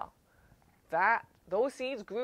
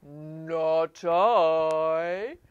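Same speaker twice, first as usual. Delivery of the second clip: a lightly lowered cow voice; a touch deeper for the cow; mild low cow refusal; 5 semitones lower, 7 dB louder than usual. Acted. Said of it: "The cow says in the low voice".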